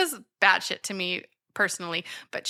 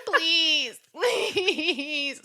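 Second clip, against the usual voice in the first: laughing mock plea; 5 semitones higher than usual. Bella begging, mock.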